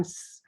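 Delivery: soft voiceless snake hiss. Shown as a demonstration. Snake answers mouse in animal sounds, soft hiss. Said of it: "the snake going".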